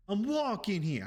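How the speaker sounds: high-pitched, hammed-up New York yell; joke New York accent. Joking about the neighbor's origins, mock New York accent. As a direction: play the brash New Yorker for laughs. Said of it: "you could hear it in her accent".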